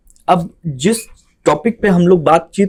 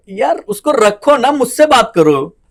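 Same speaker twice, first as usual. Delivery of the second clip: higher, drawn-out complaint; slow, pitched-up demand for attention; exasperated plea; 6 semitones higher, 4 dB louder, slower than usual. Acted. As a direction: plead like an ignored partner. Acted partner complaining about the phone.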